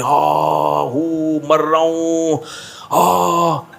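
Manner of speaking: groaning half-words of a man pretending to die; feigned dying moan. Injured rider to the narrator, groaning that he's dying. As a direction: moan it out like you're at death's door.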